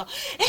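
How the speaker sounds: long piercing mock wail; tearing baby cry. Shown as a demonstration. Teacher imitates a baby with bellyache, piercing drawn-out cry. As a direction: imitate a knife-sharp colicky scream. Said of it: "찢어지는 소리, 칼 같은 소리".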